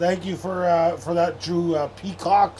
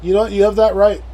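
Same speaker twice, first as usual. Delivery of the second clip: mock grand approval; 5 semitones higher, 10 dB louder, faster than usual. Mock agreeing with chat.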